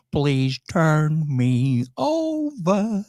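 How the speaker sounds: slow robotic vocoder voice; drawn out like a talking machine; computer voice. Retold or imitated. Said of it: "In that computer voice".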